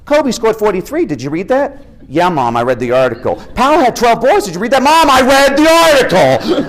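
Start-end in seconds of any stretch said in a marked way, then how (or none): 0.1-1.8 high, eager mom voice; keen mother pushing the news; excited mom. Retold or imitated. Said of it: "she gets more and more excited". 3.5-4.8 high, louder excited mom voice; the mother getting carried away; hammed-up thrilled mother; more excited mom. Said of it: "she gets more and more excited". 4.8-6.7 high, loud, slow exasperated whine. Retold or imitated. Exasperated son third time.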